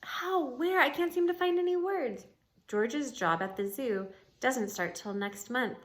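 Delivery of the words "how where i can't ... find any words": high, astonished rereading; amazed. Acted. Ivan speechless at the reunion, high and amazed. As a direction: ask it again in wonder. Repeated line, read again.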